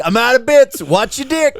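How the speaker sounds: put-on high, loud kid quoting himself; mock kid voice. Retelling the five-year-old's line, high mock kid. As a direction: play the little kid pulling a stunt. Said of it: "Just like".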